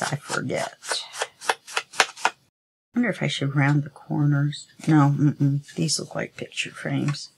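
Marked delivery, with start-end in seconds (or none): none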